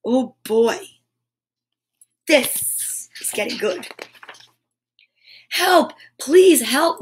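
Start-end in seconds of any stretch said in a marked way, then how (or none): 5.5-7.0 higher, mildly urgent plea; calling out. A narrator's reading. Naughty to the passing cyclist, calling out. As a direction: call for help in a raised storybook voice. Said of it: "Naughty called out".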